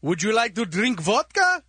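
put-on low comic Russian accent. Radio comic as Johnny Russia offering drinks, Russian accent. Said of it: "Johnny Russia".

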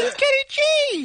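squeaky, very high-pitched and slow. Shown as a demonstration. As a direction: jump way up into a chipmunk squeak. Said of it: "I can say it with my voice even higher".

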